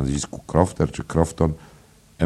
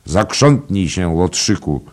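loud, slow, higher command; called-out order; 4 semitones higher, 6 dB louder, slower than usual. A narrator's reading. Traveller ordering the innkeeper to bustle, called-out order. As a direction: order a servant about. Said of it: "zawołał ów".

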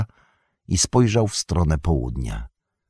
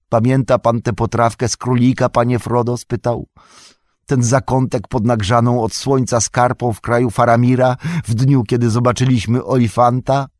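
wyżej i głośniej, Sam wspomina z rozrzewnieniem; głos Sama, tęskny; 5 semitones higher, 3 dB louder than usual. A narrator's reading. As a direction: zapytaj z nadzieją, wspominając. Sam voiced by narrator. (Sam pyta Froda o potrawkę z królika, z tęsknotą)

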